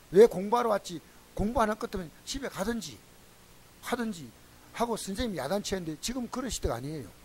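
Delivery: ordinary delivery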